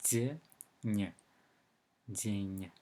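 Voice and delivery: "день" with both consonants palatalized, first split, then whole; soft D and N. Shown as a demonstration. Teacher shows what the soft sign does, softened consonants. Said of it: "makes this letter N sound softer".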